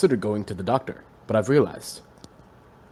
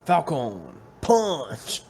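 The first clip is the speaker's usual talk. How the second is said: slow dramatic Falcon Punch; 2 semitones higher, 3 dB quieter, much slower than usual. Pretend. Game catchphrase impression.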